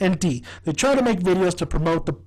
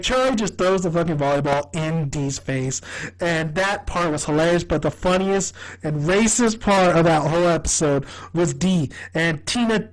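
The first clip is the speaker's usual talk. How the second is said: normal talking voice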